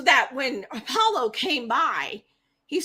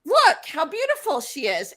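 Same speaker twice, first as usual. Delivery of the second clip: sheepish sweet-talk; 5 semitones higher, 4 dB louder than usual. Retold or imitated. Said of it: "he's like".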